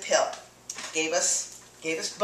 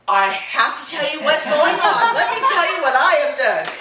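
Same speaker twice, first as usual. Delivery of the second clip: louder, higher, fast and laughing; laughing excitement; 9 semitones higher, 11 dB louder than usual. Retold or imitated. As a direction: burst out eager to share. Eager to tell friends.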